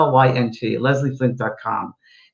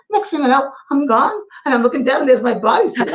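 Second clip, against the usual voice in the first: Scottish woman; 12 semitones higher, 4 dB louder than usual. Retold or imitated. Medium retelling a Scottish spirit, high Scottish lady voice. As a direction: play the old Scottish woman, bemused. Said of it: "it was a Scottish woman".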